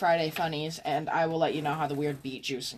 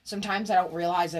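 ordinary talk, nothing marked

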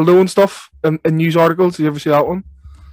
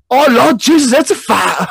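higher, louder hammed-up alarm; mock fire panic; 8 semitones higher, 4 dB louder, slower than usual. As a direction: cry out like a woman fleeing fire. Imitating viral news woman.

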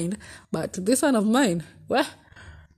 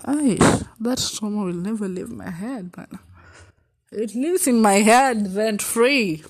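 usual speaking voice

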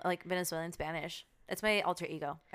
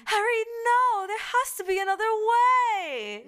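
high, loud, drawn-out British movie cry; British accent; 11 dB louder, slower than usual. Acted. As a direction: plead in an over-the-top British accent. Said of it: "my other one is".

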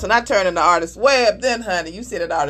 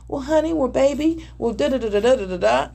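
mock sweet calm wife; 4 semitones higher than usual. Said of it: "if you stay in this calm manner".

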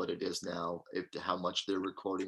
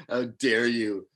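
mock outrage; 2 semitones higher, 8 dB louder than usual.

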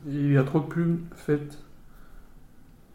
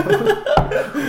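high-pitched laugh; 5 dB louder than usual. Real, not acted. Joking about a friend's mock funeral.

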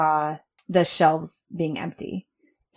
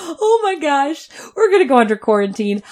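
higher, strained cry of panic, fading faster; panicked freak-out; 6 semitones higher, 5 dB louder than usual. Retold or imitated. Telling a friend how she panicked over quarantine, half-crying panic. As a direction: relive the moment you panicked. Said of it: "I just started freaking out".

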